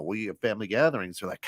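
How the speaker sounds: ordinary delivery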